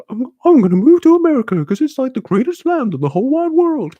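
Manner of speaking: put-on high, fast, excited kid voice; naive younger self. To friends, his naive younger self gushing about America, high and excited. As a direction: gush like a starry-eyed kid.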